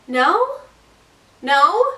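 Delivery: high, questioning no with a steep rise; retold baffled denial. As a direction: deny it as a puzzled question. Baffled reply to the accusation.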